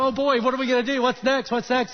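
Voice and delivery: lightly voiced overjoyed dog; eager dog voice. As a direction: jump around like a dog begging to play. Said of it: "jumps up on her to say".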